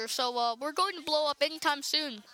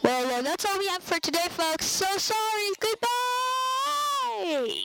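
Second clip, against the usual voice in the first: outro voice, climbing much higher to goodbye; hammed-up sign-off sung out high; mock loud outro; 8 semitones higher, slower than usual. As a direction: belt out a goofy outro, each word higher than the last. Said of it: "That was just me being loud".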